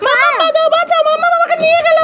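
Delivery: put-on high-pitched child-character cry for rescue; child calling for help. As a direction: call out in panic as a little one. Acted child calls for rescue. (বাচ্চার সরু গলায় বাঁচানোর ডাক)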